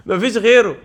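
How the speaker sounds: loud happy repeat; gloating at the pull; excited. Real, not acted. Celebrating the pull.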